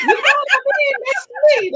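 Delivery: high loud laughing shout. Real, not acted. Friends laughing on the podcast, loud laughing shout. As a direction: burst out laughing at yourself. Laughing loudly at her own story.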